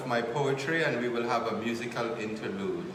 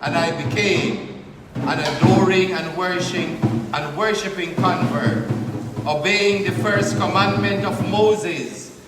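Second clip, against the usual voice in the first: measured, louder spoken-word poem delivery; higher, projected poetry reading voice; poetry recitation; 7 semitones higher, 8 dB louder, slower than usual. Acted. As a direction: recite it line by line with deliberate pauses.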